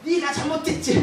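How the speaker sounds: mock the husband flaring up; 남편이 버럭 화내는 흉내; angry snap. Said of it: "남편이 버럭 승질을 내면서".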